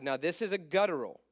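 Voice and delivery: ordinary talk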